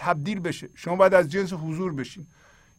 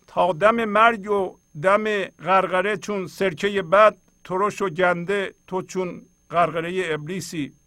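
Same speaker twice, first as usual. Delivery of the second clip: slow, raised, louder verse reading; poem recitation; 3 semitones higher, 4 dB louder, slower than usual. A narrator's reading. Teacher reads Molana's verse to the audience, poem recitation. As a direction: recite the verse slowly and emphatically.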